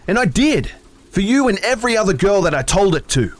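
very high, loud rage; furious shout. A narrator's reading. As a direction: scream it at her. Furious quarrel.

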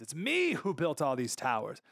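ordinary talk

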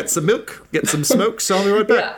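high, casual mock voice for a quick shop run; lightly mocking a casual errand; mock errand-runner. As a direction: toss it off high like popping to the store. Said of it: "like a run to the corner store".

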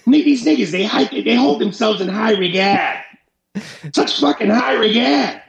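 high-pitched, indignant mock Bostonian; Boston accent. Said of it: "Dude, you do the Boston accent so nice".

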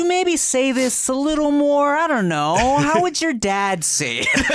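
high, drawn-out, coaxing agent; hint at it sweetly, mock-tentative and sing-song; hinting Hollywood agent. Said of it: "sometimes they don't directly imply".

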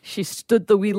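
put-on Scottish accent. Playing up the Scottish lad.

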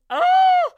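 very high, very loud "oh" squeal; delighted squeal. Pretend. Gushing to listeners about adulting, ecstatic squeal. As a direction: burst into a piercing playful squeal.

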